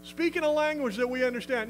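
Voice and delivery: a hammed-up, eager, welcoming voice; mock coaxing. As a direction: sarcastically coax the demon to talk. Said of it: "No, he didn't say that".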